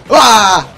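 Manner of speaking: loud sudden cry; startled yell. Real, not acted. Headshot by an enemy, startled yell. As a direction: yelp at the hit. Shot in the head.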